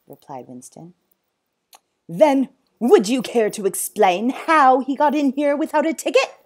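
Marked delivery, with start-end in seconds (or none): 2.1-6.4 loud, high, sarcastic demand; angry challenge. Acted. Zoo owner questioning zookeeper, angry challenge. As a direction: press him for an answer, rising in pitch.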